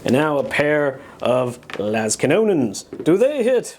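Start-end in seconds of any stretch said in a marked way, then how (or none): 3.0-3.8 high, hyped cartoon announcer voice; Saturday morning cartoon announcer. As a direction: announce it like a kids' TV narrator. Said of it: "Why do I suddenly sound like a Saturday morning cartoon announcer?".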